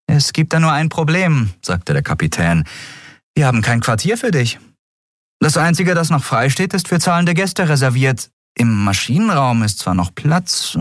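calm and matter-of-fact; captain Andriel. Acted.

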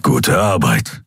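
slow, low growled praise; growling. A narrator's reading. Keru praises Nelen over his shoulder, growling. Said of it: "knurrte Kero über seine Schulter".

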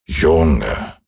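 tiefer, ruhige alte Stimme; gutmütiger alter Mond, etwas tiefer. A narrator's reading. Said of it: "sagte der gute alte Mond". (tiefe, gutmütige Mondstimme)

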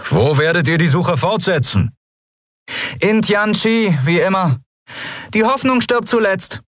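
high-pitched calling voice; calling over engines. Acted. Two friends parting beside engines, high calls. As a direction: lift the voice high to carry over noise.

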